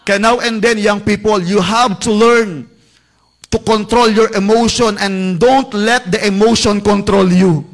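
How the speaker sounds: loud, fervent preaching; preaching at full force. Acted. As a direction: pound the message at the youth, loud.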